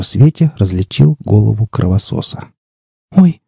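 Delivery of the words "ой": put-on high, girlish little yelp; mildly startled, high-pitched exclamation. A narrator's reading. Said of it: "девушка слегка отшатнулась".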